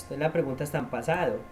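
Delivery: usual speaking voice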